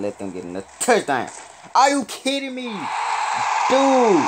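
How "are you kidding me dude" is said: loud, high wail of disbelief; real high-pitched protest, loud and drawn out; outraged disbelief. Real, not acted. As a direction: cry out at an unfair play. Outraged at being cheated in game.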